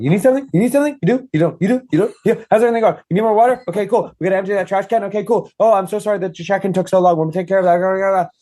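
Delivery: a stressed host darting between guests; frazzled organizer running around; frantic event organizer. Retold or imitated. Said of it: "I was running around going".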